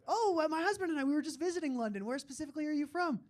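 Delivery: high-pitched, chatty cashier impression; interested cashier. Cashier who just visited London pressing him, high interested voice. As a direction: imitate her keen interest, pitched well up. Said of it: "she was really interested".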